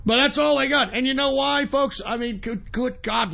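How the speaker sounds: ordinary delivery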